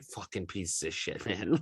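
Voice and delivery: low, slow muttered insult under the breath; muttered curse. Retold or imitated. Fan muttering a curse at the players' video, low and slow. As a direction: growl it low and slow, half to yourself.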